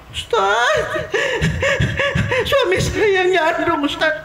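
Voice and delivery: high-pitched laughing complaint; laughing wife. Acted. Wife laughing while telling the ustadz her husband strayed. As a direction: giggle through the complaint. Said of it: "Misalnya contoh".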